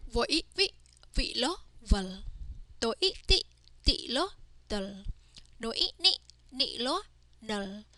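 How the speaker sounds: teacher's sing-song spell-and-read drill. Shown as a demonstration. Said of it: "Let's practice reading these words".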